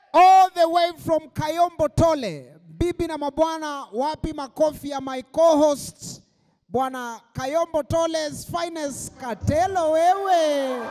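high, drawn-out hype announcer; stage announcer introduction. Acted. Host brings on Kayombo Tole's finest, drawn-out announcer shout. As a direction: call the act on, stretching out the names.

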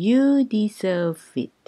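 very slow, each syllable clear; slow teaching pace; slow drill. Shown as a demonstration. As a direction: spell it out slowly for learners. Slow second take.

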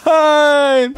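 long drawn-out playful wail; despairing cry. Pretend. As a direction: stretch one word into a mock-tragic cry. Loses a life in the game.

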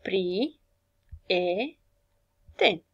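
Pri, e, ten said as separate beats with pauses; syllable by syllable. Shown as a demonstration. Teacher splits prieten into syllables for the class. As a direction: clap out Pri, e, ten one syllable at a time. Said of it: "câte silabe are acest cuvânt".

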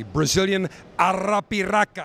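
"Brazilian Arapiraca" with the R of "Arapiraca" rolled and each syllable spaced out; proper pronunciation. Shown as a demonstration. Showing a cigar maker how "Brazilian Arapiraca" should sound, rolled R. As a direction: show off "Brazilian Arapiraca" like a native, rolling the R. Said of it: "you gotta say it correctly".

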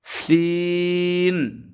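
সিন drawn out long and level; three-count stretch. Shown as a demonstration. Teacher demonstrating long সিন. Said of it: "তিন আলিফ".

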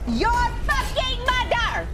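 high, drawn-out angry yell; furious shout. Real, not acted. Streamer cursing during a match, furious shout. Swearing at the game.

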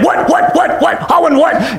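mock flustered sputtering; hammed-up baffled stammer; frustrated and upset. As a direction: stutter the question, pitched a little up. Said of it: "they just get all frustrated and upset".

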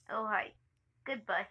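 ordinary talk, nothing marked

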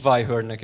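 "Weihörnek" said with an English-like "vai-hörn"; English teacher's mangled "Weihörnek"; English-style mispronunciation. Shown as a demonstration. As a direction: anglicise vihar into "Weihörnek". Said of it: "a vihart is Weihörnek ejtem".

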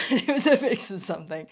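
loud laugh through the words; laughing off a botched accent; laughing at herself. Real, not acted. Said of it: "not really sure what that accent was".

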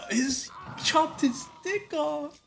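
very high, slow, appalled voice; horrified squeal. Real, not acted. Player reacting to his dick chopped off, horrified squeal. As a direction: squeal it out in horror.

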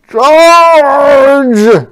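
loud, high, long-held battle cry; shouted order. Acted. General ordering the army forward, shouting. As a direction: bellow the command and hold it. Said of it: "ordered the general".

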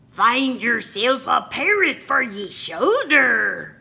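loud, high hammed pirate voice; pirate father bossing his son around; gruff pirate captain. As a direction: play the blustering pirate dad.

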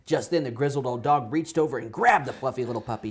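ordinary delivery

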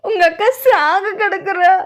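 loud, high sobbing wail; crying. Acted. Telling madam her sister is dying, crying. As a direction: bawl it slowly, drawing out the last word. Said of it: "ஏன் இப்படி அழுற?".

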